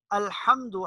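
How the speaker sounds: الحمد with a soft breathy ہا like a cool sigh; الحمد, the h breathed out gently; الحمد with light ہا. Shown as a demonstration. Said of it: "اس کو ہا پڑھنا ہے".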